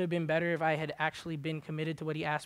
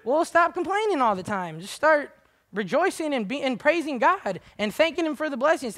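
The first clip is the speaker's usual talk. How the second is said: high, loud preaching push; fiery preaching; 8 semitones higher, 7 dB louder than usual. Real, not acted. Preacher rousing the congregation, loud and high. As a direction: drive it home from the pulpit.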